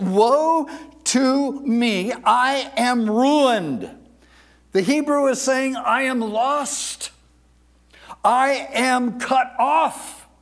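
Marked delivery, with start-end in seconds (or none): 0.0-3.9 high, loud, very slow lament; crying out. Acted. Preacher voices Isaiah facing judgment, crying out. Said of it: "He cried out". 5.8-10.3 raised, drawn-out declamation of doom; crying out. Acted. Preacher gives the Hebrew of Isaiah's cry, crying out. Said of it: "He cried out".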